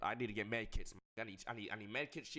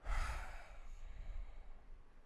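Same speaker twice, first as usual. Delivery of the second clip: long weary sigh. Real, not acted. Sigh before praying part.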